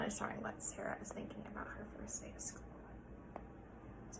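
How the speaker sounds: fast unvoiced whisper. Real, not acted. Teacher whispering to the class about Sarah's thoughts. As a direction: whisper it quickly.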